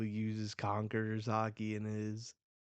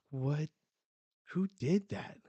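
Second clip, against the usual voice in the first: sharply higher and louder, startled; shocked outburst; 6 semitones higher, 5 dB louder than usual. Acted. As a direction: jolt up in alarm at the blast. Sudden huge explosion.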